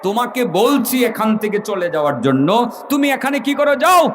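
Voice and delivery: high, forceful scolding; stern roaring rebuke. Acted. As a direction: roar the name and order him off. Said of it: "কঠিন হুংকার দিয়ে ধমক দিয়ে".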